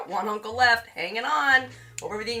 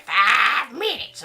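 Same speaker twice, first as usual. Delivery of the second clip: drawn-out, louder excited announcement; excited mock call; 5 dB louder, much slower than usual. Streamer retelling pressing start on a poll, mock excitement. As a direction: stretch it out with eager excitement. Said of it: "'cause I get excited".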